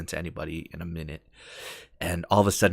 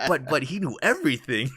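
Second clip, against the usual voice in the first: lightly voiced, higher stammering protest; Bill's protest; 7 semitones higher, 3 dB louder than usual. Bill protesting to Arthur, high mock protest. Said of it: "And Bill's like".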